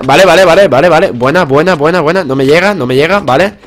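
loud, high rapid-fire repeats; urgent excitement. Real, not acted. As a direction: hype yourself through the fight. Excited mid-fight, landing hits.

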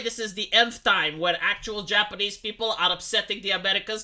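ordinary talk, nothing marked

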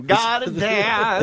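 slow, drawn-out showbiz voice; sing-song Broadway drawl; mock Nathan Lane.

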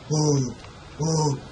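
puffing, breathy "oh"s; winded gasps. Pretend. Gamer out of breath from running, panting. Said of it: "I'm out of breath".

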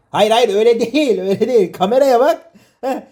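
can't stop laughing while correcting him; a dad cracking up at his son's mistake; laughing through it. Real, not acted. Laughing while directing kid.

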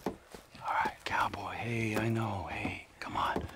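whisper. Acted. Trevor soothing Johnny in a whisper before the kill. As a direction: whisper soothingly to lull him.

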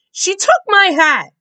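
high, loud fierce accusation; furious boy; fiercely. A narrator's reading. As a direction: accuse her with force. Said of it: "Josh said fiercely".

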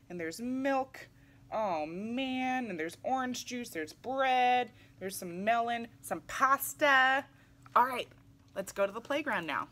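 ordinary delivery, nothing marked

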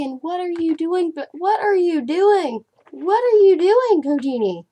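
building from puzzled to loud alarm; alarmed at the cat. Real, not acted. Scolding her cat, rising alarm.

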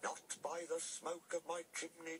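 high, slow fearful refusal; drawn-out, pretend-frightened singsong; play-scared refusal. A narrator's reading. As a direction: chant it high like a threatened little pig. Toby playing the frightened little pig.

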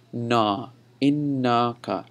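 "Na" alone, then a drawn-out "innaka"; correct na sound. Shown as a demonstration. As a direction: model innaka slowly with a long open na. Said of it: "exactly how you have to say it".

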